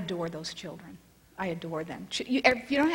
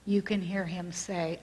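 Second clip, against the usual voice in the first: ordinary delivery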